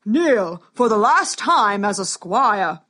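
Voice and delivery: slow, loud solemn command; booming warrior king. Acted. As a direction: command him gravely to kneel.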